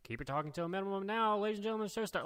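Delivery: high-pitched, hurried imitation of a house announcer; quick announcement. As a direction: rattle off a quick mock pre-show announcement. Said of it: "it was real quick like that".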